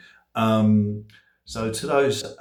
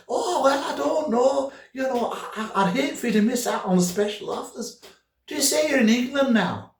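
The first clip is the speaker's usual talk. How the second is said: high, put-on Scottish-Irish woman; hammed-up Scottish phone operator, pitched way up; broad Scottish accent; 4 dB louder than usual. Operator pushes special offers on the caller, broad Scottish accent. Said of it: "she was an Irish, Scottish person".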